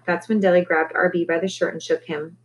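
plain reading voice

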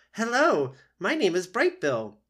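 high, loud chirpy greeting; a gosling introducing himself to anyone; proud little gosling. Acted.

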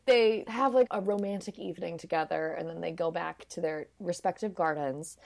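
ordinary talk

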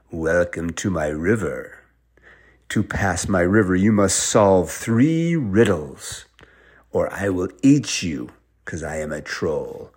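low, drawn-out troll voice; menacing troll. Acted. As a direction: welcome them with slow, theatrical menace. Plays the riddle troll.

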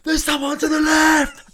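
high, loud, drawn-out mock battle cry; panicked wrong call. Hopeless paintball player calling it all wrong, mock panicked shout. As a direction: yell a bogus warning to the team. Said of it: "calling it all wrong".

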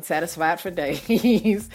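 slow, drawn-out with a laugh. Shown as a demonstration. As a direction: savour it, chuckling. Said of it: "I, I mean".

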